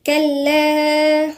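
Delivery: slow, melodic tajweed chant; measured Quranic recitation. Acted. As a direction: recite the verse slowly, drawing out the vowels. Recites the verse.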